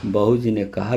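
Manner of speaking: ordinary delivery, nothing marked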